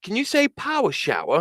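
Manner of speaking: high mock English voice asking for "power shower"; lightly mimicked English tourist making a request. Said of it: "And he goes".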